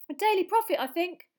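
put-on a starstruck kid bubbling over; excited Colin. Starstruck boy gushing to Harry outside, high and excited.

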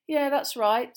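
high, loud offhand answer; offhand reply. Acted. Harry brushes off Malfoy, offhand. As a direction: toss the answer off. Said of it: "said Harry offhandedly".

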